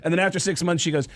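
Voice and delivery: normal talking voice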